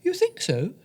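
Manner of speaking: high, astonished question; bewildered. Acted. Director asking the audience member, astonished and high. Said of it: "said the bewildered man".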